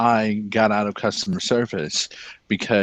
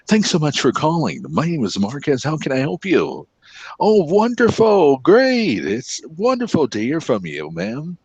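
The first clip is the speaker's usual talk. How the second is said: bright, higher call-center agent voice, swooping up on oh wonderful; customer service voice; 5 semitones higher than usual. Shown as a demonstration. Showing his friend how he handles callers, in a chipper, higher phone-agent voice. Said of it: "I'm the king of customer service".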